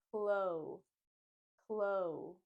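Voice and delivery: clo with a rounded, drawn-out o. Shown as a demonstration. Teacher building up clothes, rounded clo. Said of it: "round out the mouth and add the o".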